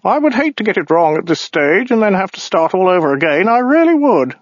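high, fussy alien whine; nitpicking little bureaucrat, pitched high; weaselly voice. Acted. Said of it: "weaselly voice".

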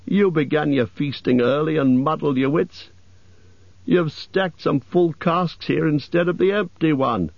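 high, mildly accusing elf voice; scolding elves. A narrator's reading.

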